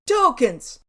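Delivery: curt, loud mock bark; barked demand. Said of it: "and barking".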